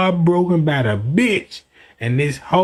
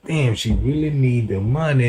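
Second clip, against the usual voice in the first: sympathetic musing; 7 semitones lower than usual. Retold or imitated. His own thought answering her plea.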